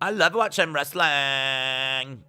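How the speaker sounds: slow mock female drawl; held sentence endings. Shown as a demonstration. Streamer demonstrating his female voice, slow drawn-out ending. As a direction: drag out the last syllable as long as possible. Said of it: "hold on to the end of the sentence".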